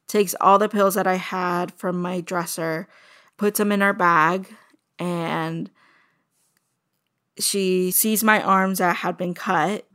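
usual speaking voice

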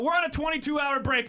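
shouting. Real, not acted. Shouted sign-off.

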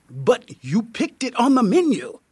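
put-on high-pitched, startled protest in an everyday accent; accent dropped. A narrator's reading. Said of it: "you drop your accent".

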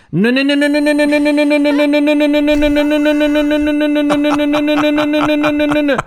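scolding the dog with a watch in its mouth, frantic; mock frantic no's. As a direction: stop the dog in a rush of no's. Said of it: "and I was like".

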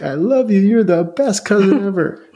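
high, gushing thanks with a laugh; overjoyed cousin. Retold or imitated. Retelling a thrilled cousin thanking him, high and gushing. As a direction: squeal your thanks to the cousin who helped.

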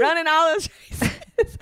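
high teasing copy of a Southern twang; imitating Amy's Texas accent, laughing; mock country accent. [name] teasing Amy, mimicking her Southern twang. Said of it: "I've never heard you have an accent like that".

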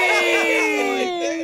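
high yelp of ay; high shouted ay. Real, not acted. Shouted cry in reply.

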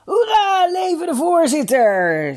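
high, loud festive hurrah; shouted cheer. A narrator's reading. First team interrupts to toast the chairman, shouting a cheer. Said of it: "schreeuwden de lui van het eerste".

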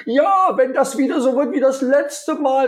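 high, whiny, grumbling "Ja, wenn das wieder so wird"; annoyed complaint. Retold or imitated. Colleague complaining about the last meeting, annoyed whine. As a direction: grumble with old resentment.

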